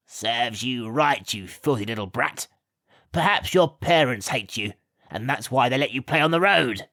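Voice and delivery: a hint of a nasty servant sneering. A narrator's reading. Gawk sneers at Alice, lightly voiced spite. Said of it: "sneered Gawk".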